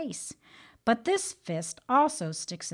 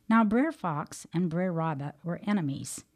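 ordinary talk, nothing marked

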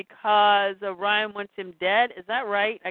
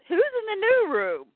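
high surprised Scott; 10 semitones higher than usual. Acted. Scott asks in acted quote.

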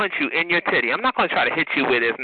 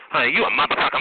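usual speaking voice

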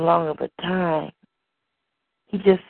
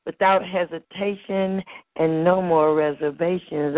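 ordinary delivery

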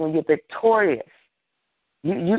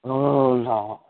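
mock whining. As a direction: imitate a self-pitying complainer. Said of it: "does not like whining and whimpering".

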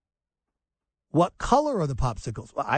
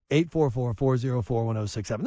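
normal talking voice

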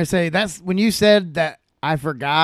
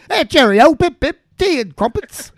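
high, hammed-up English accent, louder; 8 semitones higher, 4 dB louder than usual. Host replays his English accent from last episode. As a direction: play the silly English toff. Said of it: "brought out my, uh, my English accent".

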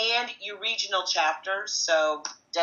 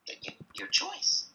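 dismissive whisper; 13 semitones higher, 5 dB quieter than usual. Retold or imitated. Brushing off the angry ticket buyer.

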